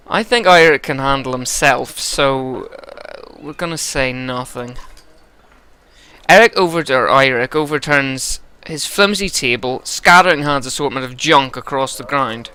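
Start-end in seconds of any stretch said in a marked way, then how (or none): none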